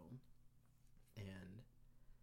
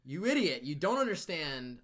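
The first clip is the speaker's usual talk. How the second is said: exasperated scolding; 4 semitones higher, 13 dB louder than usual. Retold or imitated. Nurse scolding patient who fears his mom, loud exasperation. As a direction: scold him hard, fed up.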